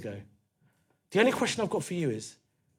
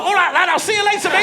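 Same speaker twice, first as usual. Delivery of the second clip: high, loud Scouse farewell; hammed-up Scouse shout; Scouse taxi driver; 12 semitones higher, 9 dB louder than usual. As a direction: hurriedly holler goodbye as a Scouse cabbie. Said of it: "the taxi driver was like".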